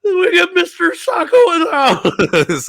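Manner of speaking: very high, loud, drawn-out squeal; slow, squeaky mock introduction; Mankind puppet voice. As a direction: announce it in a goofy falsetto. Voices Mankind revealing sock puppet.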